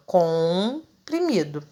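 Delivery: "Comprimido" slowly, with a long nasal õ first; "Comprimido" with M silent, heard only as nasal O; nasalised O. Shown as a demonstration. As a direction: hold the nasal "Com" then finish "primido". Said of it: "o O que fica nasalizado".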